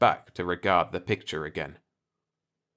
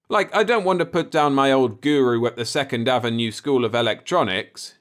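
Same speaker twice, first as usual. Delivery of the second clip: solemn; 5 semitones higher, 6 dB louder than usual. A narrator's reading. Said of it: "he added solemnly".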